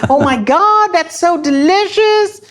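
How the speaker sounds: high, gushing mock old lady voice; mocking a sweet old American lady, very high and sing-song. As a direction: ham up a doting American granny cooing over a boy. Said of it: "they're like".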